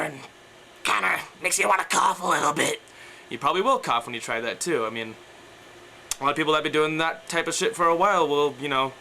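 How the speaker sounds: ordinary talk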